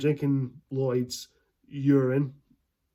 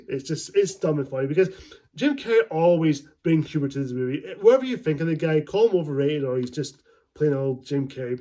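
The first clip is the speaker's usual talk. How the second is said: normal talking voice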